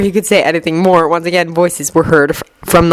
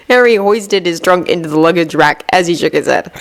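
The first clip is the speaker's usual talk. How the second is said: usual speaking voice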